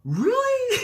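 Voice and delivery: high, loud mock-incredulous outburst; fired up. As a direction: squeal it in hammed-up outrage. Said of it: "fired up inside".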